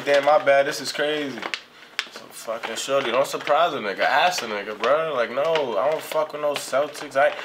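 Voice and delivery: ordinary talk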